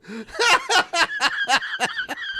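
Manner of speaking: shrieking laughter; high-pitched laugh. Real, not acted. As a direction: lose it in a high squeal. Laughing fit.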